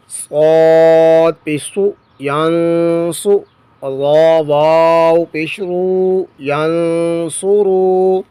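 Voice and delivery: slow, drawn-out spelling in a reciting tone; measured recitation drill. Shown as a demonstration. Teacher spells Quranic words aloud for students, measured recitation. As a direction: chant each letter and vowel as if before a class.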